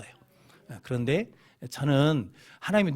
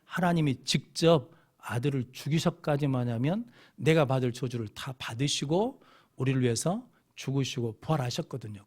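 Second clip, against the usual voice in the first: ordinary talk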